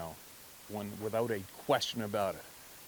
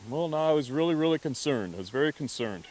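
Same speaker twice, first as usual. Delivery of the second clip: insistent understatement; 5 semitones higher, 6 dB louder than usual. Retold or imitated. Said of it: "He would not say he was scared".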